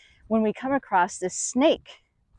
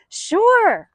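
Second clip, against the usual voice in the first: higher and louder, bright and eager; eager yes; 11 semitones higher, 5 dB louder than usual. Retold or imitated. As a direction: jump in keenly before she can answer. Eager young colleagues say yes.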